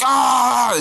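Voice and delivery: high, held-back mock scream of pain; imitated scream. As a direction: act out dad's fake agony. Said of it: "screaming and".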